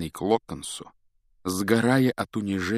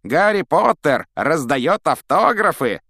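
loud, slow, high announcement; straining yell; 8 semitones higher, 9 dB louder than usual. A narrator's reading. Malfoy mockingly announces Potter to the whole yard, yelling. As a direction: crow it across the yard, stretching the words. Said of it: "надрывал глотку Малфой, обращаясь к ученикам".

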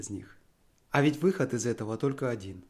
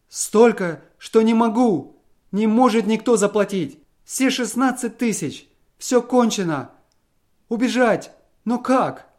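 high, loud, slowed desperate cries; a trapped man with no way out; frantic despair; 8 semitones higher, 8 dB louder than usual. A narrator's reading. Husband tells wife the sixteen thousand is lost, frantic.

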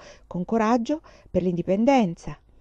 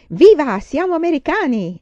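much louder, higher jubilant shout; big cheer; 7 semitones higher, 8 dB louder than usual. A narrator's reading. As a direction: shout it out, full of pride. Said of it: "disse papà saltando fuori dal letto".

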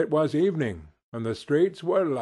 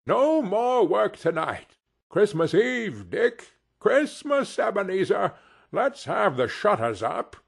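high, hearty holiday announcement; merry old master, pitched up; jovial Fezziwig; 6 semitones higher than usual. A narrator's reading. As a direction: declare the holiday with rich good cheer. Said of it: "called out in a comfortable, oily, rich, fat, jovial voice".

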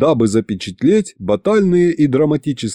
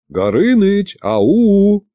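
calling out; 8 semitones higher, much slower than usual. Acted. Calling the dragon by spoon.

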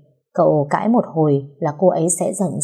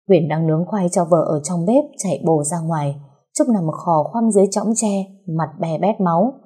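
ordinary talk, nothing marked

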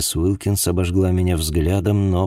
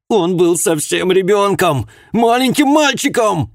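screaming grief; 14 semitones higher, 6 dB louder than usual. Acted. Grieving mother screams at detective.

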